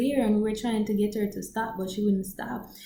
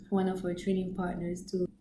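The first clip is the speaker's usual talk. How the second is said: ordinary talk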